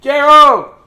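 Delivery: very high, very loud yell; high piercing cartoon yell; Piggy yells. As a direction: scream the name to scare him. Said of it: "she yells".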